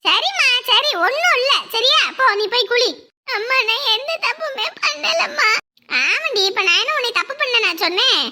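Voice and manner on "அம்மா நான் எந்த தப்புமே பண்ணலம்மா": slow tearful plea; whimpering to mother; crying. Acted. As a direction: protest innocence through sobs. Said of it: "நீ அழுவாத".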